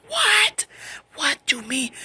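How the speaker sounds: very high, loud mock shriek; shocked outburst. Relative reacting to the three-way news, shocked. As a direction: exclaim in acted disbelief.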